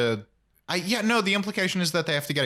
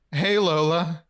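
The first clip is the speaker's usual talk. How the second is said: mock-terrified greeting, pitched up; 4 semitones higher, slower than usual. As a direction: imitate a freaked-out boy squeaking out hello. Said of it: "he looks terrified".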